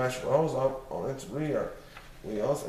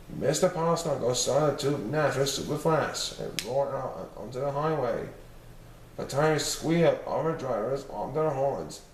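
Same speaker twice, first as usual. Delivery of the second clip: ordinary delivery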